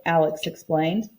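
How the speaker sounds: ordinary delivery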